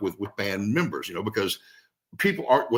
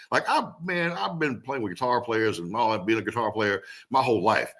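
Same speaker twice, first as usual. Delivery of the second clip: ordinary talk, nothing marked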